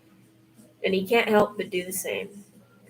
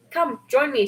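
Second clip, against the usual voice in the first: light holler across the water, raised and bright; 5 semitones higher, 4 dB louder than usual. A narrator's reading. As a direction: call her friend in, half-shouting. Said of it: "she hollers".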